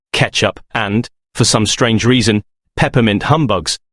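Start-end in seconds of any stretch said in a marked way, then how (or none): none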